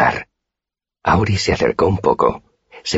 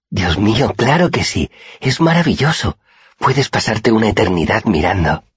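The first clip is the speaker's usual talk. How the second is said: growing excitement; 7 semitones higher than usual. A narrator's reading. Auri thrilled, telling her friend about the Underthing, rising excitement. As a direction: pitch climbing higher with delight, then settling. Auri's eyes light up.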